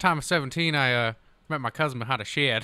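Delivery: put-on country accent. Amusing friends with outdoor tales, country accent. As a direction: spin a yarn in country accent. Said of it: "this here is a country accent".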